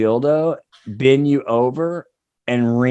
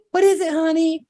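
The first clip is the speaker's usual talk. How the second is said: much higher falsetto wife voice; mock wife; 13 semitones higher than usual. Wife answering her husband, high falsetto. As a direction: squeak it like a sweet housewife.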